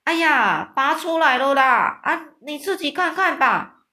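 loud, high gleeful cry; friendly giant proudly announcing the fang is out; triumphant exclaim. Acted. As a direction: show it off with mock triumph. Giant triumphantly showing the pin.

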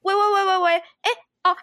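high, repeated hellos as if the signal dropped; playacted phone-cutting-out hellos; faking a bad line. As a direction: pretend you can't hear the caller. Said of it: "那我要赶快用一招".

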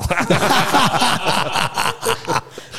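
a long, high, delighted laugh at his own joke. Real, not acted. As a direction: laugh hard at the punchline. (讲完笑点后高声长笑)

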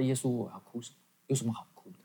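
soft, muttered question to oneself; retold inner questioning. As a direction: quietly ask it as a private thought. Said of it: "我就开始心里面就会问".

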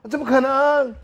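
shocked disbelief. Retold or imitated. Shocked at being only speaker.

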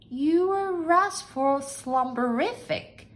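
high, very slow, doubtful echo; drawn-out, high maid's disbelief. A narrator's reading. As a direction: question it slowly in a high voice. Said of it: "asked Nellie Mae doubtfully".

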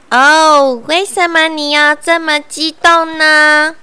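little bug voice. Acted. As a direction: pipe up as a tiny bug. Bug answers bear.

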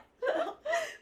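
高亢的尖声笑; 笑到破音; 尖声大笑. Real, not acted. 讲到傻眼处大笑. (讲自己看牙被痛到，尖声大笑)

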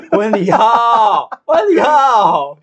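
high, loud and stretched out; drawn-out cry. Real, not acted. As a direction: wail it slowly at the top of the voice. Drawn-out cry about after the wedding.

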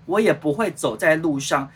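ordinary talk, nothing marked

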